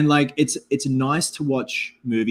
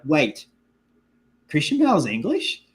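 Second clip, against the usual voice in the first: pause, then a higher incredulous question; mock surprise; 5 semitones higher than usual. Imitating viewers learning he's British, mock surprise. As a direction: pitch jumping up into disbelief.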